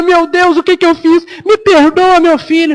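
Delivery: remorseful mother. Retold or imitated. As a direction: cry out in a sudden fit of remorse. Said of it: "E ela".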